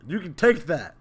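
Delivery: usual speaking voice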